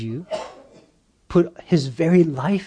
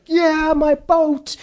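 put-on high, slow and proud as a small boy; proud little boy; 12 semitones higher, slower than usual. Little boy proudly admiring his new boat, high and slow. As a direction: beam over the boat like a child.

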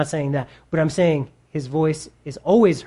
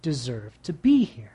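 low, slow and softer accuser; accusing enemy voice; 4 semitones lower, 4 dB quieter, slower than usual. Acted. Preacher as the enemy keeping you from God, slow accusation. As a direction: drag out each damning word, low and quiet. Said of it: "the voice of the enemy that says".

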